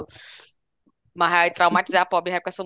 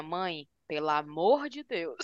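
mock whining daughter; 8 dB quieter, much slower than usual. Daughter complains, voiced.

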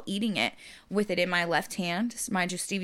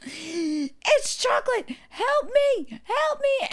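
high mock wail, climbing higher on the plea; crying child; 13 semitones higher, much slower than usual. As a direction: imitate a sobbing child begging for help. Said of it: "So she's crying".

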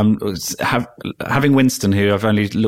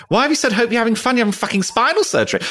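high, fast, horrified inner voice; inner panic; faster than usual. Retold or imitated. Scolding himself in his head, high, fast and horrified. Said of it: "I'm thinking".

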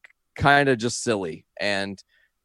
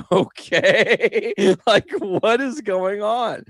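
higher and slower, laughing through the question; giggling quote; 10 semitones higher, much slower than usual. Retold or imitated. Recalling his puzzled reply to Nash, laughing. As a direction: ask it while cracking up.

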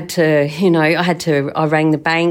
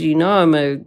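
ordinary delivery

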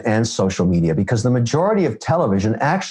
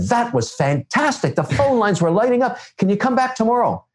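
excited producer; 11 semitones higher than usual. Retold or imitated. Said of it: "and said".

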